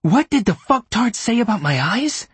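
high, frantic disbelieving cry; panicked high-pitched outrage; inner scream. A narrator's reading. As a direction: scream it in your head, high and strained. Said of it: "Klaas screamed to himself".

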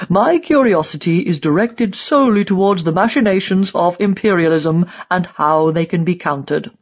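put-on old propagandist declaiming his creed; emphatic character voice, pompous; haughty old ideologue. A narrator's reading. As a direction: declare it loftily as an old die-hard.

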